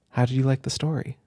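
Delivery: usual speaking voice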